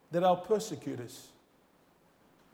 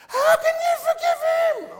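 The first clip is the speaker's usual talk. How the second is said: crying out; 9 dB louder than usual. Retold or imitated. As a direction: scream it in outraged disbelief, far above your usual pitch. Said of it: "She was really going off her head".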